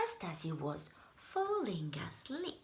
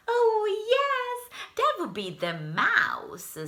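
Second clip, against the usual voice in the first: much higher, louder bright reply; cheery bellhop; 6 dB louder than usual. Acted. Bellhop explaining the mouse, cheerful. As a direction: answer cheerfully.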